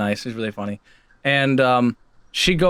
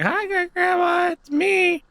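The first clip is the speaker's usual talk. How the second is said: high, slow singsong hello; acted cheery greeting; slower than usual. Visitor to forgetful great grandma, acted high greeting. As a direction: greet her slowly in a high voice.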